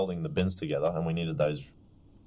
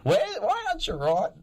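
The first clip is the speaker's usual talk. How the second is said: high squeaky put-on voice, much higher; mock teacher; 3 dB louder than usual. Retelling the teacher's question in a squeaky voice. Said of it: "And so I was like".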